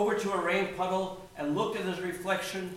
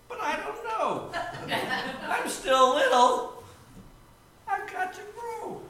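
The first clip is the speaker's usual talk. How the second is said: put-on high-pitched baby bird voice; small, unsure young bird; timid little bird; 10 semitones higher than usual. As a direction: plead helplessly in a high little voice. Little bird answers rooster as best he can.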